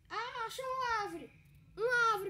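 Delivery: thrilled to find a tree; excited shout. Real, not acted. Finding a tree in game.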